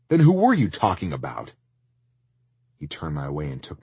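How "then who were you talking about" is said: loud, higher, exasperated question. Acted. Tristan presses the owner about the buyer, loud and exasperated. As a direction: push for an answer, loudly. Tristan baffled, demands an answer.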